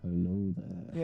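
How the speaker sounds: slow, low boomy close-mic voice. Shown as a demonstration. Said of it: "move the microphone closer".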